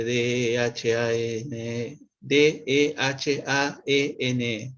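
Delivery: slow, letter-by-letter spelling; each letter given its own slow beat. Shown as a demonstration. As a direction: spell the name slowly in Spanish letter names. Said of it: "se escribe".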